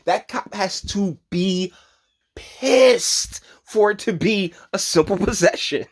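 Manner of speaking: drawn-out stress on pissed, breaking into laughter; slow it down, laughing through the words; laughing disbelief. Real, not acted. Host ranting to viewers about possession, laughing disbelief. Amused rant about police.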